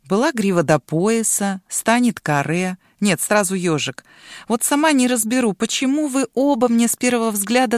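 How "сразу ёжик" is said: plain reading voice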